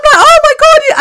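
very high, loud squeal; hammed-up horrified outburst; mock horror. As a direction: shriek it in comic alarm. Retold alarm at thirty minutes.

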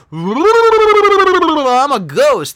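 high, loud, long wavering ghost wail; spooky ghost. Pretend. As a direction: play a hammed-up ghost, drawn right out. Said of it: "You're listening to a ghost".

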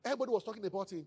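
ordinary talk

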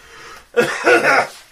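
breathless high giggle in the odd voice; high laugh. Acted. Spirit laughing at the voice it speaks with, high and breathy. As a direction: laugh at your own strange new voice. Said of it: "God, this voice".

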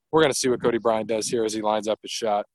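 ordinary talk

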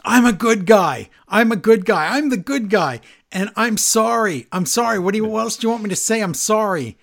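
hammed-up desperate protest; mock screaming. Retelling Miz yelling at Johnny, a mock pleading scream. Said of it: "Miz is screaming".

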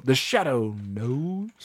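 slow, drawn-out mysterious intro; the Shadow. Retold or imitated. Demonstrating the radio show's catchphrase, imitated Shadow voice. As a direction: stretch it like an old radio tagline. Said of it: "gonna make you do a shadow".

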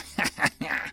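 staccato manic laugh; short burst of cackling; gleeful cackle. Acted. Gunner laughing as he fires, gleeful cackle.

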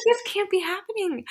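high, loud disbelieving wail; retelling her distraught reaction. Retold or imitated. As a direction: cry out that this can't be happening. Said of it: "I was like".

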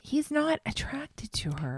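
hushed, slow whisper. Real, not acted. Telling a co-host a groom's secret, whispering. Confiding gossip about a contestant.

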